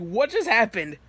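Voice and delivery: loud, high retold panic; terrified. Natsuki coming out of paralysis, terrified. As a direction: blurt it out in mock terror. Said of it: "she was terrified".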